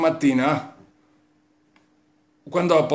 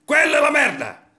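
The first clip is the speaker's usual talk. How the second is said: high shout; 12 semitones higher than usual. Acted. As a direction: shout it much higher. Comic rant, repeated punchline.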